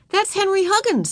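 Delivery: put-on high-pitched small child's voice; helpful little girl. A narrator's reading. Girl telling teacher the boy's name, eagerly helpful. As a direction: pipe up eagerly with the answer.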